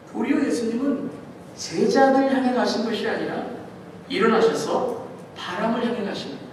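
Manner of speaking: ordinary delivery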